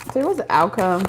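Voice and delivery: ordinary delivery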